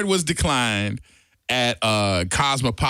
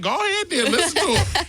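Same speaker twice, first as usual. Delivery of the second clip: high laughing egg-on. Retold or imitated. John urging the waitress to go ahead, high and laughing. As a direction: push him on, amused. Friend egging on the order.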